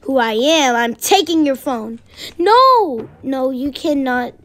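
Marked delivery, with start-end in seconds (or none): 2.4-3.1 protesting shout. Acted. Girl protests phone taken.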